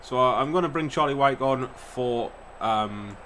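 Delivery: usual speaking voice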